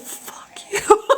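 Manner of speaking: unvoiced, high, biting whisper; whispered fuck you; hissed fuck you. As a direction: spit it out under your breath. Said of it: "I said".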